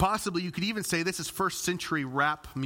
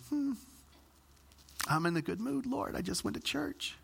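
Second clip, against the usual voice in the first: softer, strained self-soothing; forced calm; 6 semitones higher, 6 dB quieter than usual. Retold or imitated. Pastor praying to the Lord to stay calm, softer forced calm. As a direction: talk yourself down under your breath.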